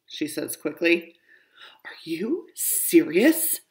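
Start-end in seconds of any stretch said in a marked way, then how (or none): 1.9-3.6 slow, awed whisper. Acted. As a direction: breathe the question in wonder. Child asks about scary robber.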